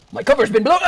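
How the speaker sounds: loud dramatic cry; mock alarm. Streamer spotted in game, loud mock alarm. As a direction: announce it like a spy caught out.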